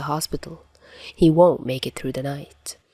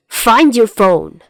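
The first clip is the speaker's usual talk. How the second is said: loud, drawn-out demand; shouted text; 5 semitones higher, 9 dB louder, slower than usual. A narrator's reading. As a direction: yell it at him. Chuya's angry text.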